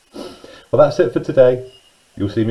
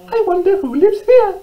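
very high, squeaky mouse voice; squeaking little mouse; 4 dB louder than usual. Acted. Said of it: "she squeaked".

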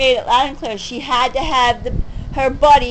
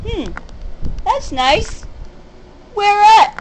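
high-pitched fake friendly question; mock interest; 8 semitones higher, slower than usual. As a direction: feign interest in a high, mocking voice. Mock chat with the boaster.

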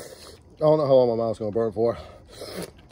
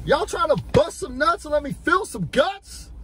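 higher, hammed-up seductive come-on; over-the-top seductive voice; 13 semitones higher than usual. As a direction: play it as a silly seducer, way up high. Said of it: "I got out of control".

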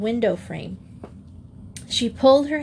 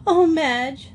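high, louder tearful cry; a child's acted sob, pitched up; sobbing; 7 semitones higher, 5 dB louder than usual. A narrator's reading. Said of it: "sobbed Joey".

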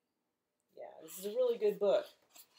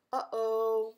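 gasping playful uh-oh; mock surprise; 3 semitones higher than usual. Librarian to kids, playful gasp. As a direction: gasp like you're worried for him.

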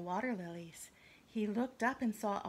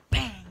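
loud bang; 11 dB louder than usual. Acted. Landing sound.

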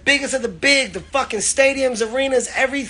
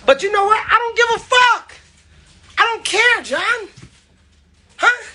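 hyped up; 12 semitones higher than usual. Real, not acted. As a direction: yell it out all fired up. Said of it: "Y'all ain't never seen me this hype".

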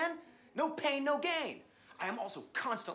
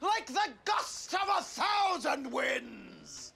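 slow, booming grand threat; grandiose threat; 7 semitones higher, slower than usual. Acted. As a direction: threaten like a thundering god.

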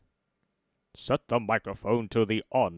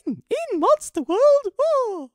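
very high, loud character voice; put-on squeal; 11 dB louder than usual. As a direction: shriek it in falsetto. Acted line in put-on voice.

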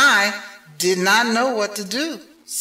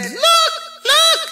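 panicky fish voice. A narrator's reading. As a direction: squeal it out in a panic. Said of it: "our fish said".

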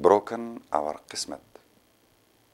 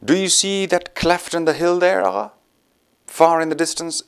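eager; 9 semitones higher, 4 dB louder than usual. Acted. Eager to lead him to India.

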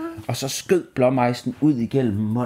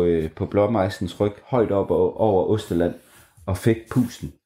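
usual speaking voice

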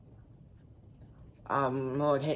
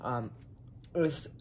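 ordinary delivery

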